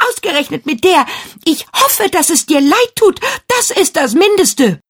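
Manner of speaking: indignant scolding. A narrator's reading. Girl scolds the silent mirror.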